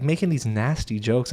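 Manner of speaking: usual speaking voice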